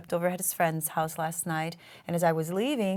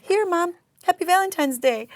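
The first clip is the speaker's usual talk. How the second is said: son's words lightly voiced, higher and louder; sweet son; 10 semitones higher, 7 dB louder than usual. Son hands mom a Valentine card, sweetly. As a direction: quote the son's sweet greeting, pitched up. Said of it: "it was just so sweet. He goes".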